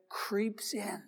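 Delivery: hushed whisper; creeping whisper. Acted. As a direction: let it creep in on a breath. Sin creeping into hearts.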